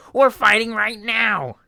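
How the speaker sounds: the skeleton bursts out; loud indignant Papyrus. Acted. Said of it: "Papyrus voice".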